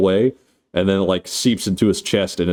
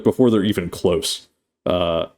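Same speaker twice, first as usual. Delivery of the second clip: ordinary delivery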